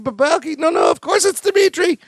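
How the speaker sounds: squeaky high pleading Larry; mock-high excuse. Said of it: "Larry's like".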